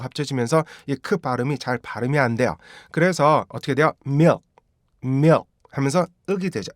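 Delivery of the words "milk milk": milk cut off before the k is released; swallowed final k. Shown as a demonstration. Teacher shows the k changing inside sentences, unreleased k. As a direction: say "milk" as "myuk", stopping short on the k. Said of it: "윽이나 아니면 윽 이런 식으로 변해요".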